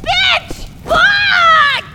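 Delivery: loud, high angry cursing; frustrated yelling. Real, not acted. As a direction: scream in frustration. Frustrated outburst.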